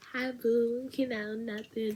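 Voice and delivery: high, soft flirty reply. Retold or imitated. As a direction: coo back sweetly.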